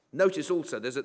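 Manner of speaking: ordinary talk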